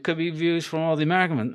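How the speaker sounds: ordinary talk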